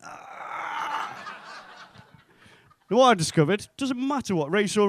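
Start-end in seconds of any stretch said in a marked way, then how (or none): 0.0-1.1 a high, teasing gotcha sound; a cheeky high ah from behind her; high smug ah. Retold or imitated. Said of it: "takes one step behind the girl and goes".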